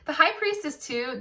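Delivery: ordinary talk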